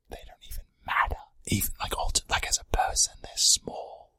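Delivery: breathy whispered put-down. Acted. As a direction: whisper it low and sneaky. Conspiratorial aside about others.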